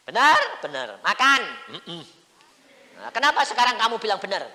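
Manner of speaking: high, loud urging. Retold or imitated. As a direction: press him to eat. Urging father to eat.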